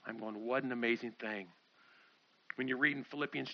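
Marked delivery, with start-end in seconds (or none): 0.5-1.5 low, soft amazement; hushed wonder. Acted. Preacher reacting to Jesus choosing to serve, hushed wonder. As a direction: marvel quietly, voice dropped.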